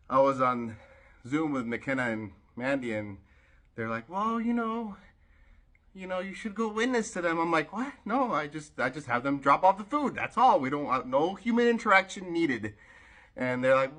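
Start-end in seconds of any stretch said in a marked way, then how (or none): 4.1-7.4 high-pitched, coaxing friend voice; imitating coaxing friends. Voicing friends nudging her. 7.7-12.7 high, loud, insistent objection; animated retold protest. Acted protest to friends' suggestion.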